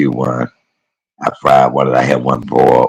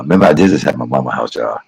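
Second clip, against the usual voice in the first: ordinary talk, nothing marked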